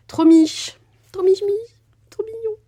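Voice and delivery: very high-pitched cooing over something cute; gushing cutesy squeal. Real, not acted. Crafter coos over a tiny cute nutcracker, high delighted squeal.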